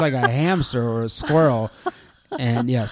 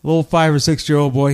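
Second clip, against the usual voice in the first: ordinary delivery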